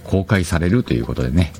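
ordinary talk, nothing marked

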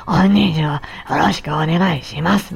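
a rough voice that also goes airy; rough husky voice. Shown as a demonstration. Speech therapist demonstrating a husky, rasping voice to listeners. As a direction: rasp and breathiness mixed together. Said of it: "ガラガラ声としわがれ声が重なったりとかして".

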